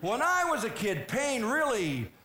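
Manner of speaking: high mock tough-dad lecture, laughing through it; gruff dad impression. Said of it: "he'd say".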